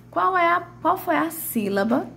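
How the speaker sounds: usual speaking voice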